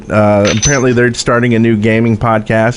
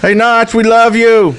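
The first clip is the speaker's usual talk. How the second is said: from another room; 11 semitones higher than usual. Pretend. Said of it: "do we wanna say".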